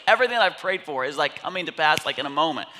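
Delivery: loud, high, rushing out; overwhelmed excitement. Retold or imitated. Dad marvels at prayers answered, overwhelmed. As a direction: break from the whisper into an excited rush.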